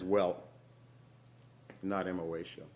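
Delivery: plain reading voice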